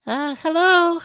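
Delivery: put-on high, loud falsetto girl. Co-host auditioning on request, exaggerated girl voice. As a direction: pipe up in a put-on girly falsetto. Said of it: "Let me find your girl voice".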